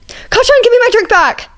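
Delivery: indignant yell. Acted. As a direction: shout after the friend who took your drink. Izuku yells across library.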